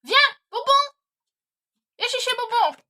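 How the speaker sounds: loud, high coaxing call with a treat; coaxing shout. Real, not acted. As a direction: lure the dog back, calling loudly. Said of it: "Désolée si je crie". (appel fort et aigu avec une friandise)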